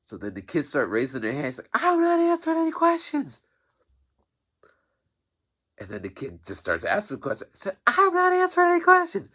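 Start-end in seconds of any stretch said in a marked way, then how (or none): 1.7-3.4 much higher, louder mock refusal; a high put-on lady shutting the kids down; imitating the office lady. 7.9-9.3 much higher, louder mock refusal; a high put-on lady shutting the kids down; imitating the office lady.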